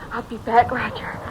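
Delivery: normal talking voice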